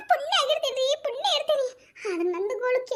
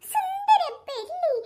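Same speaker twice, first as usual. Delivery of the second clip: mock sweet talk; 8 semitones higher, much slower than usual. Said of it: "ಕೊನೆಗ್ ಅಂತಾರ".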